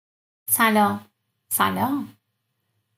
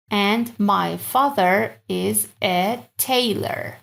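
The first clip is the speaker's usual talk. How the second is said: slow model; much slower than usual. Shown as a demonstration. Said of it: "slow translation".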